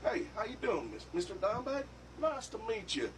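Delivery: butter him up like a schmoozing small-town cop; friendly cop impression. Cop greeting the metal guitarist at the station, mildly high schmoozing voice. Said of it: "The guy walks up and he goes".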